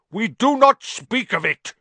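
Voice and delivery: loud fierce spider voice. A narrator's reading. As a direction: forbid it fiercely. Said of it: "said Aragog fiercely".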